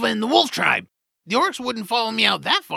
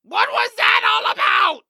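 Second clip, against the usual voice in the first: exasperated shout; 13 semitones higher, 5 dB louder than usual. Acted. Hero yells at his friend after the orc leaves, shouting. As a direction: shout it out, much higher and louder.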